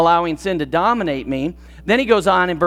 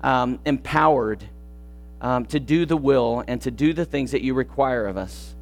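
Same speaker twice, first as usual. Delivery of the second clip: soft, low prayer voice; hushed closing prayer over music; 4 semitones lower, 4 dB quieter than usual. Acted. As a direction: pray it quietly and humbly.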